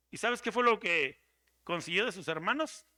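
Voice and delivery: normal talking voice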